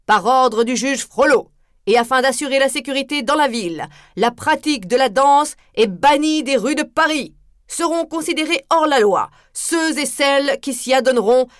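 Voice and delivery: high, loud, official proclamation; herald's decree. Acted. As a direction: read the judge's decree to the crowd. Herald reads the ban aloud.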